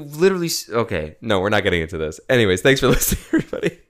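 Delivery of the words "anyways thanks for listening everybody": high, louder sign-off through laughter; laughing sign-off. Real, not acted. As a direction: wrap it up laughing, exasperated.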